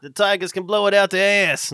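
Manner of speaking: put-on loud, high, botched Boston accent; bad Boston accent. Podcaster mocking a rival city's team, bad Boston accent. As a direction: belt out a badly faked Boston accent. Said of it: "That was a bad accent".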